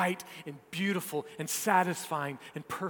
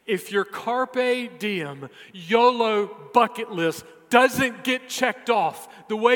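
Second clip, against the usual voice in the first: slow, raised, emphatic preacher's voice; impassioned preaching; 4 semitones higher, 5 dB louder, slower than usual. Real, not acted. Preacher offering a motto that cannot fail, impassioned and slow. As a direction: proclaim it, stretching each phrase.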